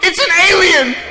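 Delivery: put-on very high, loud girl's shriek to mum; little girl crying out to her mother about the alien; frightened little girl. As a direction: shriek it like a scared little girl calling for help. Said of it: "she goes".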